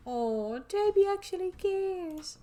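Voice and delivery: high, slow, sing-song tease; mock surprise. Real, not acted. Player teasing a fellow player, mock-touched. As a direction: coo it like it's a miracle.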